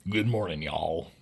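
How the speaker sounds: mock-American statement, sinking low on "y'all"; soft, dropped mock-American "y'all"; American declaration. Shown as a demonstration. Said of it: "Americans declare the morning to be good".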